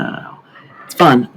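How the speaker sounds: ordinary talk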